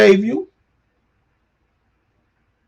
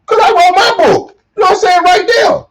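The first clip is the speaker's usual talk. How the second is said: excited; 9 semitones higher than usual. Real, not acted. Excited about showing his book.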